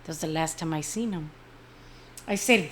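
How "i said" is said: usual speaking voice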